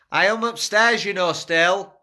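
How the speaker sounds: shouting down the stairs; calling out. Real, not acted. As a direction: call out loudly, high. Calling down to the group.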